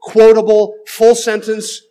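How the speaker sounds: rising preacher's emphasis, quotable hit hardest; emphatic preaching. Real, not acted. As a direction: hammer each phrase home. Preacher hammers his contrast.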